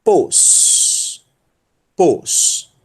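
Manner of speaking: "Pos" alone with a long final s; isolated, deliberate "Pos"; syllable demo. Shown as a demonstration. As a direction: model "Pos" for learners, pausing between repeats. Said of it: "vai ser pronunciado como".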